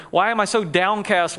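ordinary talk

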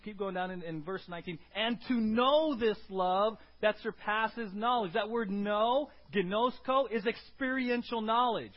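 loud, driving sermon voice; preacher urging church to grasp God's love, loud; preaching at full force. Acted.

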